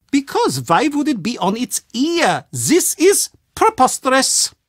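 proud German game maker, precise and a little pompous; German accent. Acted. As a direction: lecture the American about board games with German pride. German board game designer speaks.

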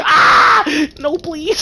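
high, pleading cry; panicked yelp. Real, not acted. A player pleading as the chasers arrive, panicked. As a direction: beg in a panic.

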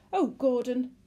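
high, loud distressed whimper; fretful woman's wail. A narrator's reading. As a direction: whimper it to your husband. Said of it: "the woman whimpered".

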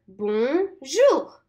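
"Bonjour" modelled for repeating; repeat-after-me "Bonjour"; slow model word. Shown as a demonstration. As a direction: say "Bonjour" for kids to copy. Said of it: "Let's say it together".

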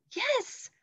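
very high, eager yell. Acted. Annie volunteering to the knight, excited yell. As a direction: shout it out, thrilled.

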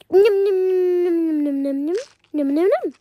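quick, loud nom-nom munching; eating noises. Pretend. Child playing mom pretends to eat, munching noisily.

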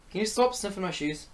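normal talking voice

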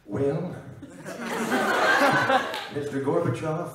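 halting presidential impression; Ronald Reagan. President addressing Gorbachev, halting put-on statesman's voice. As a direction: draw out the opener, then deliver the demand. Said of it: "Ronald Reagan".

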